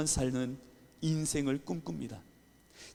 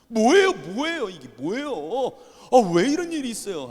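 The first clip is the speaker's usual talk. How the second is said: temper; 7 semitones higher, 8 dB louder than usual. Retold or imitated. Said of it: "성질이 나는 거예요".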